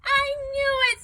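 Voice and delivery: high, loud whoop of joy; delighted whoop. A narrator's reading. Boy hearing the Yeti eats spaghetti, whooping. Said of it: "with a whoop of delight".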